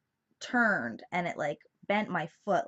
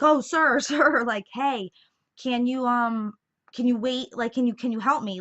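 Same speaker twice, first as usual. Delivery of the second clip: higher, louder retold plea to a stranger; calling out for help; 5 semitones higher, 7 dB louder than usual. Injured patient calling to the man with the wheelchair, retold urgent plea. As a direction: re-enact flagging someone down, raised pitch and volume.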